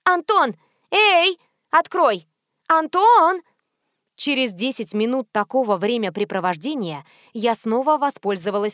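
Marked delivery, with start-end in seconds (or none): 0.0-3.4 high, loud calls; shouting. A narrator's reading. Woman banging on the gate for Anton, shouting. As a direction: yell to be heard through the gate. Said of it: "и кричать".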